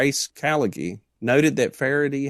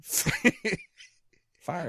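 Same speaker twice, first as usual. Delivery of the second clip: laughing; 7 semitones higher, 6 dB quieter, much slower than usual. Pretend. Name repeated laughing, stretched.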